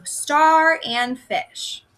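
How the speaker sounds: star and fish said slowly, apart; slow syllable demo for young readers; slow syllable split. Shown as a demonstration. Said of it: "shows where our two syllables separate".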